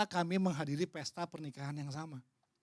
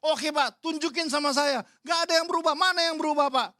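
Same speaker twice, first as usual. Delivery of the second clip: higher, louder, demanding proof; angry shouted challenge; 8 semitones higher, 7 dB louder than usual. Retold or imitated. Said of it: "Dia marah lagi". (tantangan marah dengan teriakan)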